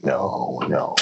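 low, drawn-out no's; dropped-pitch mock refusal; deadpan refusal. Acted refusal to reopen.

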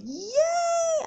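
very high, loud cheer; shrill-free high-pitched victory cheer; squealing yay. Retold or imitated. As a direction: squeal with relief. Celebrating finished order.

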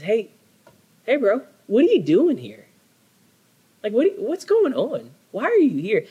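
high, bright buddy-like God; God checking on Elijah, high and casual. Acted. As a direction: call out to him like a concerned friend. God voiced casually in sermon.

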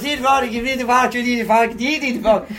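high, garbled Irish brogue; mock-Irish rambling, barely intelligible; unintelligible Irish accent. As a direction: run the words together in a thick Irish accent. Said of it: "I don't understand a fucking word".

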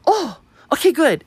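high, quick "oh" of mild relief; relieved acknowledgement. Retold or imitated. Jane answers her on the phone that it's okay, high and relieved. Jane hears the matter is settled.

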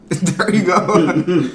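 laughing while saying it. Real, not acted. Cohost laughing as he eggs him on. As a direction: chuckle through the words.

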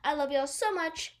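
ordinary talk

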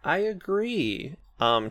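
ordinary delivery

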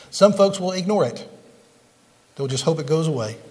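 ordinary talk

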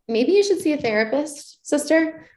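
high, playful sassy nudge; teasing. Retold or imitated. To her past self, urging therapy: playful sassy high tease. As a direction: tease yourself like a cheeky friend.

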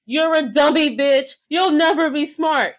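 loud sassy insult, like a button clip; mock soundboard insult. Hosts quoting a reality star's insult button, loud and sassy. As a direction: bark it like a meme soundboard. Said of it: "two buttons I want to... have as my staples".

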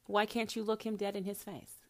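normal talking voice

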